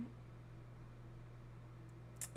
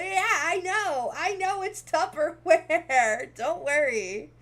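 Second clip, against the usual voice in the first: slow, loud, higher mock-patient reply; playful exasperated reassurance; 5 semitones higher, 10 dB louder, slower than usual. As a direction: drag it out, yes I know, laughing. Her reply to the commenters.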